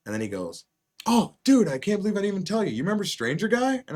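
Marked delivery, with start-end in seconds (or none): 1.1-3.8 high, fast burst of excitement; excited friend. Retold or imitated. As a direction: blurt it out like big news. Friend excitedly retelling news.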